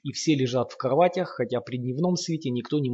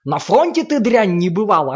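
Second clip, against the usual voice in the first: much higher, louder, full-throat roar; deafening roar; 7 semitones higher, 8 dB louder than usual. A narrator's reading. Lomov bellowing at Sasha about the front, deafening roar. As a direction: roar it loud enough to deafen. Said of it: "оглушительно орёт Ломов".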